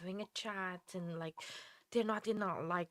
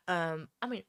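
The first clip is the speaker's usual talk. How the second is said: usual speaking voice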